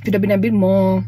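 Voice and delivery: slightly full mouth, finger on lips; eating between words; talking while chewing. Real, not acted. Seen 3: Bites burger at -2, then chews and licks finger while talking.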